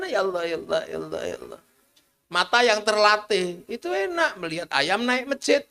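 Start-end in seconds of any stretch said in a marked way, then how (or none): 0.1-1.6 low murmured ya Allah chant; soft dhikr demo. Shown as a demonstration. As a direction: murmur the dhikr, fading right down. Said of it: "kalau datang ke sini latihan".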